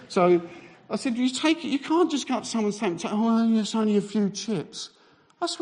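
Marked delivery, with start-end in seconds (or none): 1.2-4.9 high-pitched indignant protest; acted anger. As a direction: protest in mock outrage. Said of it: "I'm getting quite angry".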